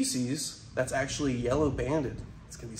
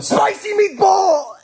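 big joyful yell; excited shout; 12 dB louder, much slower than usual. Real, not acted. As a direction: belt it out with glee. Shouting the snake's nickname.

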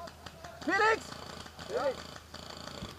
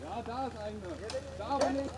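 hushed; 5 semitones lower, 11 dB quieter than usual. Real, not acted. Quiet calls while sneaking.

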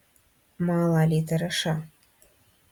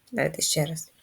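ordinary talk, nothing marked

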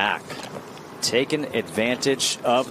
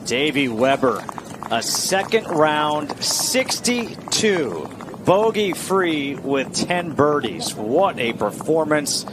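raised, slow, celebratory announcement; golf commentator; 5 semitones higher, 4 dB louder, slower than usual. Acted. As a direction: build it up like a highlight call. Announces a career-best round.

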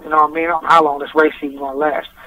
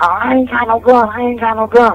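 imitating brother's scared denial; pleading brother; 7 semitones higher than usual. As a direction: beg them to stop, scared. Brother being beaten, denying gun.

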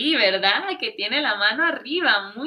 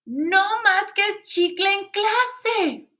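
stern teacher scolding; 9 semitones higher, 7 dB louder, slower than usual. Acted. Teacher scolding in read story.

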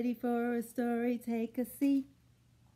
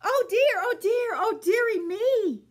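much higher, louder fretting; worried horse; 8 semitones higher, 8 dB louder than usual. Acted. Horse frets over the fallen animals, high and loud. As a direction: fluster like an anxious old horse. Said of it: "says worried Mister Horse".

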